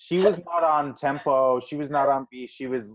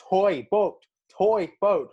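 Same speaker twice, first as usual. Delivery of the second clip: vocal exercise; 5 semitones higher, 4 dB louder, slower than usual. Shown as a demonstration. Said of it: "These were all vocal exercises".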